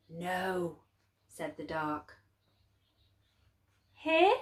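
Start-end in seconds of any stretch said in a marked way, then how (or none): 0.1-0.8 softer, creaky voice of the dark; a softer dark saying no; the dark's voice. A narrator's reading. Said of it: "The voice of the dark was as creaky". 3.9-4.3 put-on higher, louder small boy's question; young boy's hopeful question, higher; Laszlo asking. Boy Laszlo asks the dark.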